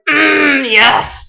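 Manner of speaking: strained hum into a pleased release; imitated tight squeeze. Musician squeezing a fan in a hug, imitated straining hum. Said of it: "squeezing as tight as he physically could".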